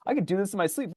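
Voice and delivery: high, breezy, boastful; cocky. Retold or imitated. Runner bragging to himself near the finish, breezy and high. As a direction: toss it off like it's nothing.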